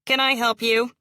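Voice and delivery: lightly voiced sharp office lady; brisk school receptionist at the desk. A narrator's reading. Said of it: "Her voice is as sharp as her features".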